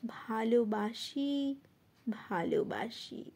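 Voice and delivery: soft, slow, low words of comfort; reassuring. Acted. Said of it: "আশ্বাস দিয়ে বলবে". (আশ্বাসের কোমল, নিচু স্বরে)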